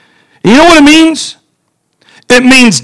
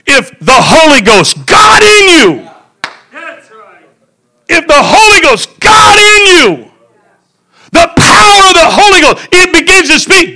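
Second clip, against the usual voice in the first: fiery preaching; 8 semitones higher than usual. Real, not acted. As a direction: build it up like a revival preacher. Preaching at full force.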